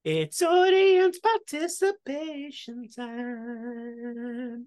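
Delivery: slow, drawn-out game-show announcing; mock announcer. Host opening the audience segment, slow mock announcer.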